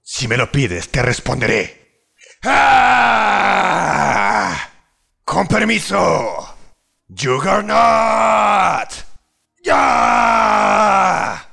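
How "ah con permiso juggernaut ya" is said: high, strained war shout; battle cry. Acted. Warrior roaring his spell name in battle, fierce high shout. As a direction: bellow the ability name high and loud. Battle cries casting abilities.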